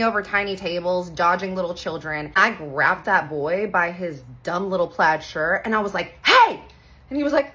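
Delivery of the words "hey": loud, very high shout; sharp yell. Retold or imitated. Aunt yelling at the boy who shoved her niece, loud sharp shout. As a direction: bark a sudden startling "Hey".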